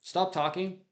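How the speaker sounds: normal talking voice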